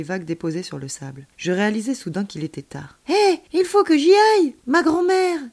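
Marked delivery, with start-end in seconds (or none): 3.1-5.4 put-on high, loud, urgent kid voice; worried child character. A narrator's reading. Child realising it is late for grandmother, anxious.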